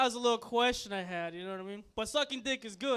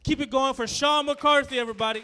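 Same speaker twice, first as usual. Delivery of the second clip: loud, high call to the crowd; hyping the crowd; 3 semitones higher, 12 dB louder than usual. Real, not acted. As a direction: pump up the room for the next act. Calling out to crowd.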